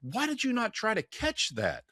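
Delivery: high, slow, incredulous question; economist in the joke. Retold or imitated. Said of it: "the economist looks at him and goes".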